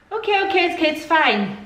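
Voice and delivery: high-pitched, hurried placating; high mock appeasement. Placating the friend in retold quarrel.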